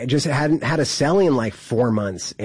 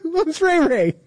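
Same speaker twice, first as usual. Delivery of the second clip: mock panic. Panicked denial, laughing while retelling.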